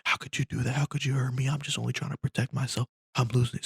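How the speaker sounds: high-pitched, wounded protest; high-voiced frantic pleading; distraught passenger. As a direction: act a man coming unhinged. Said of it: "the man gets even more violent".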